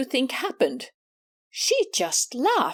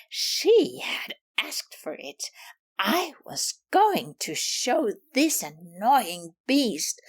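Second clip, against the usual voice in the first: plain reading voice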